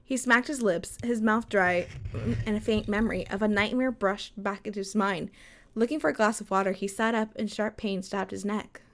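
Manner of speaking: quiet storytelling read; story narration. Acted. Reads story aloud.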